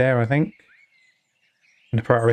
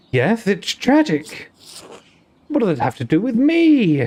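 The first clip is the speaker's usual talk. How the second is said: put-on Welsh accent; 5 semitones higher than usual. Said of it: "It's gone Welsh again".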